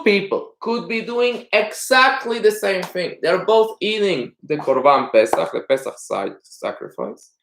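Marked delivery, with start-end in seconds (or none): none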